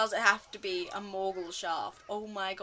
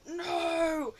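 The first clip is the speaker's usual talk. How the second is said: mock audience groan; 7 semitones higher, 4 dB louder than usual. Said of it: "everyone was just like".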